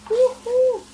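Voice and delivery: ordinary talk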